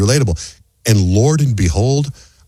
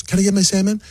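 imitating wife; 11 semitones higher than usual. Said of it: "And she goes".

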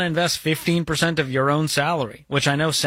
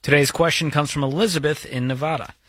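ordinary delivery

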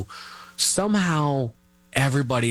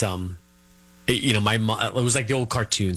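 ordinary delivery

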